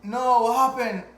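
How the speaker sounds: high, loud startled "what happened"; wife waking up alarmed; startled question. Retold or imitated. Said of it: "And she was like".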